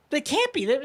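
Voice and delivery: high, protesting disbelief; mock denial. Imitating his wife refusing to believe they died. Wife quoted denying deaths.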